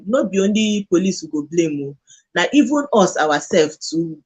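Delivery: normal talking voice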